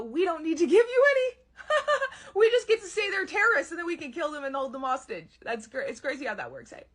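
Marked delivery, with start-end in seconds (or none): 0.0-5.4 mocking, smug official voice, higher and louder, laughing through it. Soldiers mocking a father who asks for evidence, smug and laughing. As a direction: sneer it like a power that answers to no one. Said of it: "And they go".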